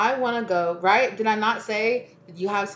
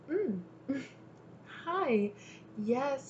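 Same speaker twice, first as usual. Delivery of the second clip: flirty welcome; 5 semitones higher, 9 dB quieter, much slower than usual. Retold or imitated. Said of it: "you're like".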